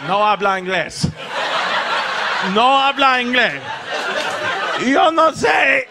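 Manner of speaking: mock Spanish, building to a high yell; teenage son dodging the dad's question in mock Spanish; Spanish playing dumb. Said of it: "And I was like".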